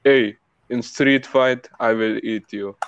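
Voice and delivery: put-on slow, measured Arabic-accented English; careful Arabic-accent threat. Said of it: "That's actually more accurate than the first time".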